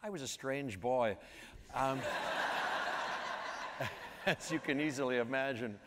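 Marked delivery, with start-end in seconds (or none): 2.0-4.2 real laugh without voice. Real, not acted. Interviewee joking about strange boyhood, real airy laugh. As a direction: break into an airy laugh at your own expense.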